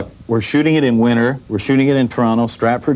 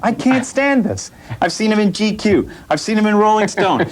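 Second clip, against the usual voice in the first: mock jealous whine; 10 semitones higher than usual. Comedian whining to the host about a rival. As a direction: play up fake outrage at a rival.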